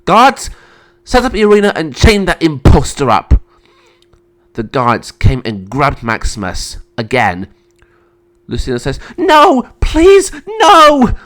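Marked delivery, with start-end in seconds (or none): 0.1-3.4 high, loud orders; commanding call. Acted. Emperor ordering guards to chain the imposter, commanding. 9.2-11.1 very high, loud cries; desperate pleading. Acted. Sister begging for her brother, desperate.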